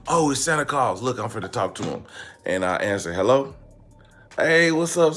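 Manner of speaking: ordinary talk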